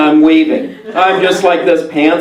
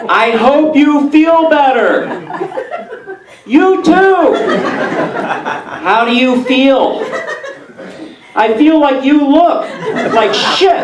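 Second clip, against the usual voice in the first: patronising people; 8 semitones higher, slower than usual. Retold or imitated. People talking down to him.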